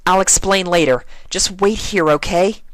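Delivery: brushing friends off quickly; Twilight, urgent. A narrator's reading. Twilight hurried.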